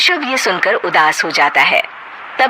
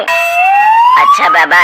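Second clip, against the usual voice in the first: giving in; 5 dB louder, much slower than usual. Acted. Husband gives in.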